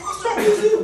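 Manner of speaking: high, fast, laughing exasperation; retold exasperated snap. Wife demanding answers from smiling ministers, high fast exasperated. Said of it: "I said".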